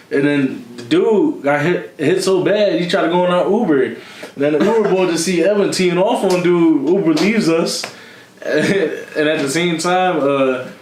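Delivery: ordinary talk, nothing marked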